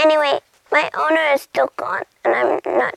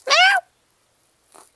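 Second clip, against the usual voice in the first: very high kitty mew; cat meow; 3 dB louder than usual. Acted. Cat excited by catnip, meowing high. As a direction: mew out in a high falling cry.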